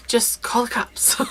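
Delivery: whispered, slow aside; a slow stage whisper. Shown as a demonstration. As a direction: breathe the advice like a secret. Whispered repeat of advice.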